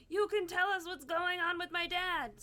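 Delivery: loud, high, fed-up demand for answers; exasperated demand. Retold or imitated. To the priest offering coffee, a loud exasperated demand. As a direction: snap back at the hovering priest.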